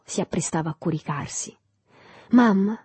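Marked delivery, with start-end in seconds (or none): 2.3-2.8 higher, loud abrupt call; loud, almost violent. A narrator's reading. Son waking suddenly calls his mother, loud and forceful. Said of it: "con voce forte e quasi violenta".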